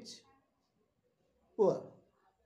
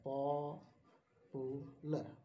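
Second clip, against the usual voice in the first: Popular stretched very slowly, syllable by syllable; slow syllable split; 4 dB quieter, much slower than usual. Shown as a demonstration. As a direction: draw out popular, each syllable separate. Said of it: "ये तीन syllable word है".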